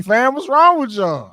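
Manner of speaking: high, loud, slow outrage; outraged disbelief. Retold or imitated. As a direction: exclaim in shocked disbelief. Outraged at eating bear.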